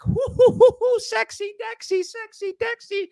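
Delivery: very high silly falsetto hooting. Shown as a demonstration. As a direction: test the mic in a squeaky falsetto. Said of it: "this sucker feels like my voice is vibrating".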